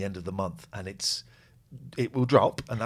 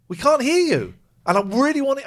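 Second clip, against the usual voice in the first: pleading; 13 semitones higher, 9 dB louder than usual. Real, not acted. Straining to reach caller.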